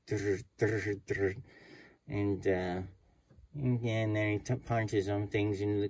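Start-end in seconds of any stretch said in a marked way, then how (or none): 0.1-1.4 imitated note counter whirring drr; counting machine noise. Teller's machine counts the notes, mimicked whirr. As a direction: mimic the money counter buzzing. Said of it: "he's counting all the notes and going".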